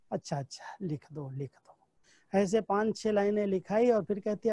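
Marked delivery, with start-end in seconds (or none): 0.1-2.4 gentle giving in. Retold or imitated. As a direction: let it go quietly, half smiling. Dadi finally gives in.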